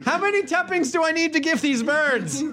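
high mock kid voice; small child. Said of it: "I am a small child".

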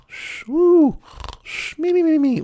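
whistling, high-pitched comic snores; mock snoring with high little wheezes; cartoon snoring. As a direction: imitate a sleeper's snore and squeaky exhale. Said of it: "they're snoring".

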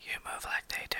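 soft unvoiced whisper, close and intimate; whispered sweet nothing. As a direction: breathe it like a lover's secret. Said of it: "you whispered it like a sweet nothing".

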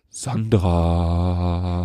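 low, dopey mock voice; lightly mocking dumb tone; imitating a dumb voice. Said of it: "Du sagst so".

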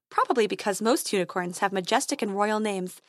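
plain reading voice

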